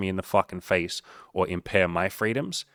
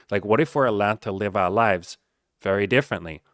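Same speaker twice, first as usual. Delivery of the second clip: usual speaking voice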